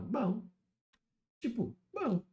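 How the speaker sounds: high, drawn-out shrug of a bom; unimpressed bom. Shown as a demonstration. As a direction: say it like it's just okay. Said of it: "Não é ruim, mas também não é nada demais".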